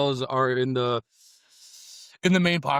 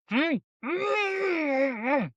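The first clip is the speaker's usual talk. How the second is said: delighted food moans; much slower than usual. Real, not acted. Savoring a great taco.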